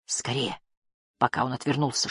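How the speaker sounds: hushed, urgent undertone; whisper. A narrator's reading. Barda urging friends to hide, whisper. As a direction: keep it very soft and secret. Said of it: "шепнул Барда".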